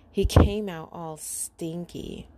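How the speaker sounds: plain reading voice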